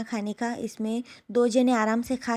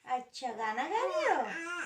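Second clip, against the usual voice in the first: high, slow sing-song cooing; 4 semitones higher, much slower than usual. Real, not acted. Mother coos to her baby about the song she's making, slow high baby talk. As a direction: draw the words out sweetly for an infant.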